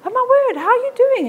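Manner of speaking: high, bright-eyed surprise at meeting her; startled, delighted greeting; oh my word, so surprised to see you. Retold or imitated. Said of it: "And I said".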